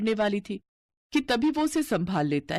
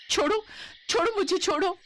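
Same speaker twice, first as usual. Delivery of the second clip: much higher, frantic cries to let go; panicked pleading; 13 semitones higher than usual. Acted. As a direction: shake him off in acted panic. Bride fights off milkman.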